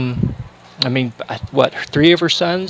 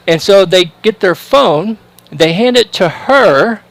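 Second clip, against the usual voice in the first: normal talking voice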